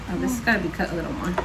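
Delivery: ordinary talk, nothing marked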